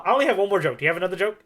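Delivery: ordinary delivery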